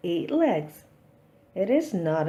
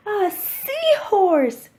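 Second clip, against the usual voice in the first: slow, much higher and louder delighted cry; excited cry; 12 semitones higher, 6 dB louder, slower than usual. Acted. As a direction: stretch it out in pure delight. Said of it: "cries Pete".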